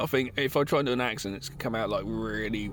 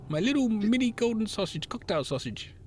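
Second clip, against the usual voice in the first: leprechaun voice; 7 semitones higher than usual. Pretend. Leprechaun boasting on his wedding night, high comic voice. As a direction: play a lewd little leprechaun. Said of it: "as the leprechaun".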